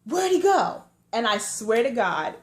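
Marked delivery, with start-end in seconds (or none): 0.1-0.8 high, loud officer's demand; cop asking. Retold or imitated. Officer leaning into the car, loud high demand. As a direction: bark the question like the cop. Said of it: "he's like".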